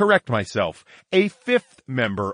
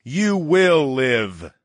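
slow, louder pronouncement; booming; 5 semitones higher, 4 dB louder, much slower than usual. Acted. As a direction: boom it out slowly. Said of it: "I boomed".

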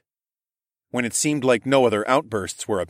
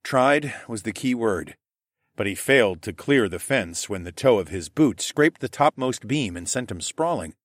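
usual speaking voice

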